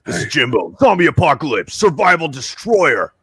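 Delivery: brash zombie destroyer talking trash to Daryl; hammed-up zombie hunter. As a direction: puff up like a gung-ho survivalist. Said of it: "I'll go from, like".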